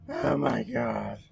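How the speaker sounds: slow, breathless gasp; winded and puffing; wind knocked out. Real, not acted. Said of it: "I may be winded".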